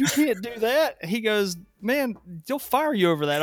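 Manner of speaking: high, slow, loud warning; loud slow alarmed warning; stern warning boss. Retold or imitated. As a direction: stop them cold, slowly. Boss stops the signing.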